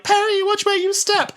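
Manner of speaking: high-pitched caution; mock exasperated warning. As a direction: warn a careless friend, hammed up. Acting viewer warning the screen.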